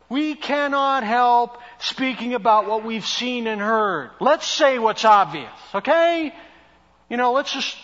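a touch of a Jersey tough guy; blunt New Jersey Peter. Retold or imitated. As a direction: say it plainly, no apologies. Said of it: "I think he was like this".

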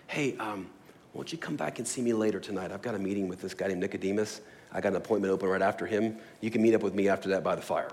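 soft, secretive invitation, voiced low and quick; hushed aside. Jesus quietly inviting the centurion to meet later, hushed. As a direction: keep it down like a private side deal. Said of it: "he could have even whispered to this guy".

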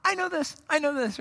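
high-pitched, jumping in with the answer; mock-eager, high-voiced interruption; eager know-it-all. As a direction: blurt it like an overeager pupil. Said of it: "jumps in".

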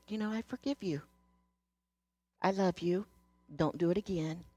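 soft, gentle pardon; aunt softly forgiving the niece who took the money; tender forgiveness. Retold or imitated.

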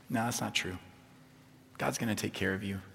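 low, soft, unhurried reassurance; calm and peaceful. Acted. Jesus answering the enemy's accusations, calm and peaceful reassurance. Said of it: "he's just speaking calmly and peacefully".

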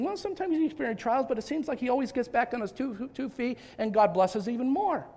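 usual speaking voice

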